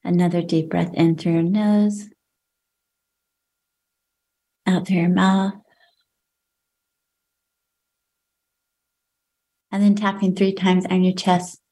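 calm, unhurried breathing guidance with long pauses; guided breathing. Acted. Leads breathing exercise.

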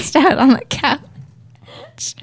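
laughing loudly through the words; can barely talk for laughing. Real, not acted. Cracking up while telling.

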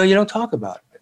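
half-whispered warning; a touch of conspiratorial whisper; hushed secret. Retold or imitated. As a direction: say it like something not to be mentioned. Retold hushing about a secret.

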